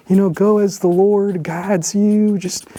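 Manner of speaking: ordinary talk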